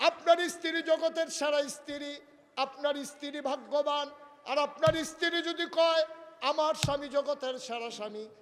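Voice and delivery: high, loud exhortation; full-force preaching. Real, not acted. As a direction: drive the lesson home to the congregation. (জোরালো উঁচু গলায় ওয়াজ)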